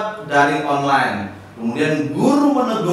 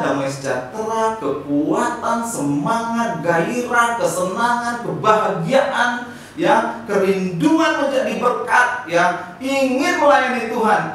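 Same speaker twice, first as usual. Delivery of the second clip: building excitement; 9 semitones higher, 4 dB louder than usual. Real, not acted. Building list of joyful gifts.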